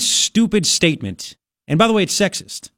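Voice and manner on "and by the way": ordinary talk, nothing marked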